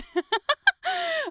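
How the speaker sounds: high, bursting laugh; high cackle. Real, not acted. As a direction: laugh out loud at your own joke. Laughs at own joke.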